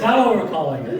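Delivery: words squeezed out through laughter; high giggling speech; laughing. Real, not acted. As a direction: talk while cracking up. Group laughing at memory joke.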